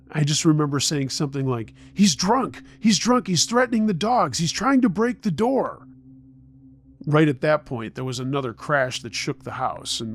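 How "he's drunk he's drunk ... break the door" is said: high, frantic report; panicked. A narrator's reading. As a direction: plead for help in rising alarm. Frightened child phoning for help.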